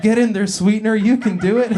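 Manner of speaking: pep talk. Acted. Barista urging sweetener into the cup, mock pep talk. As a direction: cheer it on like a coach. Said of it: "even the sugar deserves a pep talk".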